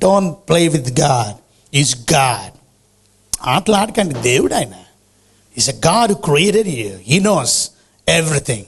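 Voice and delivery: very slow, low and grave; solemn warning. Acted. Preacher warns the church, slow and grave. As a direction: lay down each word heavily.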